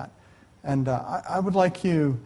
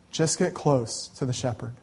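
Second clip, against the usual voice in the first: softer, gently repeated; tender; 3 semitones lower, 7 dB quieter than usual. Real, not acted. Preacher softly urging listeners toward the shepherd. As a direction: ease down and say it tenderly.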